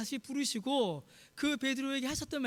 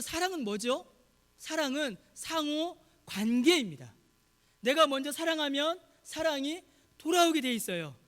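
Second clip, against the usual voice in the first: loud, raised sermon delivery; high, emphatic pulpit proclamation; impassioned preaching; 4 semitones higher, 5 dB louder than usual. Real, not acted. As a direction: proclaim it forcefully to the congregation. Preaches at full force.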